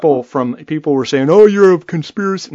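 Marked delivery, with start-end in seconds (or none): 1.3-2.5 higher, louder mimicked accuser; mock accusation. Imitating people who jumped on him over his post, mock accusing. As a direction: throw it out as a mocking imitation.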